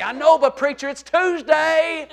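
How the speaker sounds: drawn-out last word; excited fan. Acted. Acts excited football fan.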